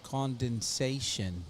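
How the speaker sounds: put-on slow drawn-out New Orleans accent; playful New Orleans accent, one word at a time. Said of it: "saying these with an accent".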